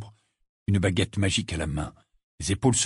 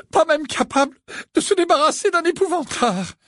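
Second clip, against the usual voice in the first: high, loud sobbing self-reproach; trembling, tearful voice; 6 dB louder than usual. A narrator's reading. Missus Wesley scolds herself, tearful wail. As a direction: wail in shame at yourself. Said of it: "d'une voix trembante".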